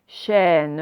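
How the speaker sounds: "Chaîne" stretched slowly, the open è held, final e voiced; sound-spotting demo. Shown as a demonstration. Teacher showing pupils the sound in a word, stretched demo. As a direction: draw out "Chaîne" so the è stands out. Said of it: "on entend le son".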